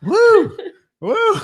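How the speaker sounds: high, loud excited whoop. Real, not acted. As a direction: cheer like at a rodeo. Whooping cheer.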